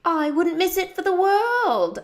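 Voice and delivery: put-on high, loud amateur English accent; fake English accent. A narrator's reading. Girl plays along with her friend, fake English accent. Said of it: "I answered in my fake English accent".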